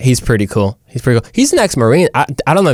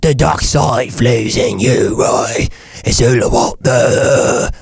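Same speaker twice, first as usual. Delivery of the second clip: slow, drawn-out British villain; menacing dark lord in a British accent; Darth Sidious; much slower than usual. Shown as a demonstration. Evil lord tempting his pupil, slow British villain voice. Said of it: "if you put a British accent".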